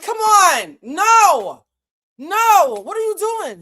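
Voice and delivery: loud, high wailing objection; long, drawn-out cries of no; horrified protest. Retold or imitated. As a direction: yell in disbelief to stop him. Story, catching painter at sink.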